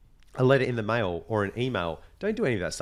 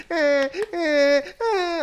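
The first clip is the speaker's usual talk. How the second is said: high, loud mock baby wail; short high-pitched wails like a fake infant; imitated baby crying; 6 dB louder, much slower than usual. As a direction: mimic a baby's repeated cry. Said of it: "playing a baby crying".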